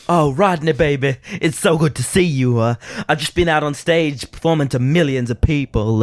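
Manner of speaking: high, loud Southern diva; hammed-up star wife. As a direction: gush to your husband in syrupy drawn vowels.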